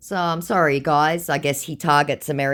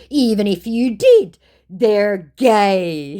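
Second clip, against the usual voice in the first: mocking scammer voice; 7 semitones higher, 8 dB louder, much slower than usual. Reading the scammer's reply.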